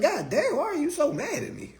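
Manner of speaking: high, mock bewildered plea; mock exasperated. Streamer asks angry commenters why so mad, mock bewildered. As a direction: play it up as wounded disbelief. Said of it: "I was like".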